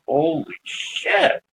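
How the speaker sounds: drawn out, half under the breath; slow awed disbelief. Retold or imitated. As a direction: stretch it out in stunned wonder. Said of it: "and I'm like".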